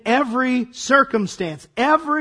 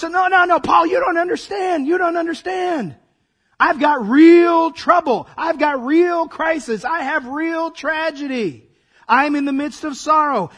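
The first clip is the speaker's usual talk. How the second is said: higher, rising to a louder cry; anguished protest; 6 semitones higher, 3 dB louder than usual. Retold or imitated. Troubled believer crying out to Paul, high and anguished. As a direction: plead desperately that nobody understands.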